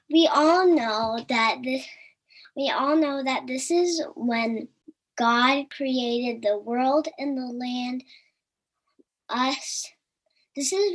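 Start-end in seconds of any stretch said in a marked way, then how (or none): none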